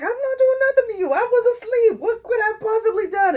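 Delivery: squeaky high protest, fast and laughing; whiny rushed mock denial; high defensive denial. Man accused by the woman, squeaky defensive protest. He denies doing anything.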